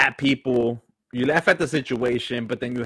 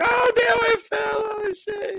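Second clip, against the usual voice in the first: mock yelp after falling; slower than usual. Falling and laughing at himself, startled yelp.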